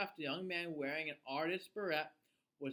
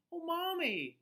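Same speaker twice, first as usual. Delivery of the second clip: put-on higher, urgent child's call; exclaiming; 10 semitones higher than usual. A narrator's reading. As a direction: call out to mommy in alarm. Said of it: "she exclaimed".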